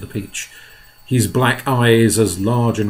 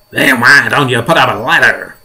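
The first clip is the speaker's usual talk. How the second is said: loud officious character voice; police chief's voice; 9 dB louder than usual. Acted. Police chief suggesting a ladder, loud character voice. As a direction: ask it loudly in character.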